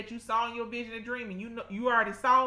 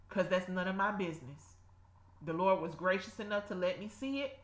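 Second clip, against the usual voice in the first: ordinary delivery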